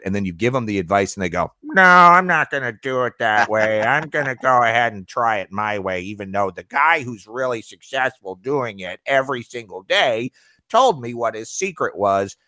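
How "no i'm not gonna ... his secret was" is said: whiny baby voice mocking a customer. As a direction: mimic a stubborn customer as a big baby. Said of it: "in that baby voice".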